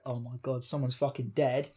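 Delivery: low, hushed dread that someone has died; panicked mutter. Retold or imitated. Woken by drunk calls, fearing someone is dead, muttered low. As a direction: mutter it in half-asleep alarm. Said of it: "I was like".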